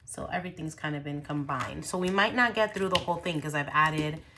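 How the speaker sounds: usual speaking voice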